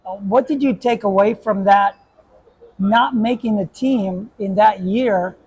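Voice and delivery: ordinary delivery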